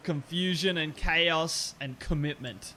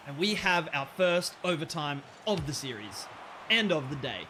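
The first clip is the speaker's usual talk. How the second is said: ordinary talk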